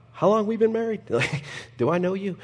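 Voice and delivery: high mock-puzzled voice, half laughing; bored long-married spouse. Pastor mimicking a long-married spouse to the congregation, high, mock-puzzled. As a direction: pitch it up like a spouse who forgot who you are. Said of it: "you're like".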